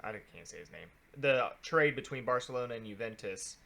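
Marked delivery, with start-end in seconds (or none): 0.0-0.9 very soft, low mutter; hushed aside. Real, not acted. Co-host stuck on a player's name, hushed aside. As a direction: drop to a near-whisper under the breath.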